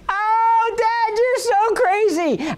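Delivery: high, slow, drawn-out mock adoration; teasing older sons. Older sons delighted at dad's answer, high drawn-out teasing. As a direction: ham up the boys cheering dad on. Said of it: "my older sons are sitting there saying".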